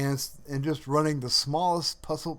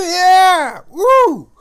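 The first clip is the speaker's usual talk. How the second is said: cheering shout; 17 dB louder than usual. Real, not acted. Cheering as one escapes.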